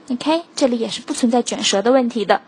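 usual speaking voice

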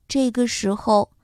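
plain reading voice

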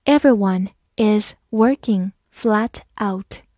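slow, word by word with gaps; slow reading. Shown as a demonstration. Teacher reads along with learners, slow reading. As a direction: take it slowly, separating each word. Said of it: "好，慢慢来读".

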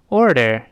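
"order" with tongue curled on both r sounds; model American R. Shown as a demonstration. Teacher reading aloud for students to copy, model American R. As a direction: clearly model "order" with two r's. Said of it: "是这么来读的，我们大声来读一下".

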